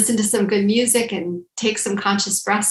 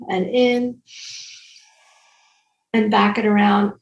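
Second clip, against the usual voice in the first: guided breathing instructions. Acted. Leads shoulder breathing exercise.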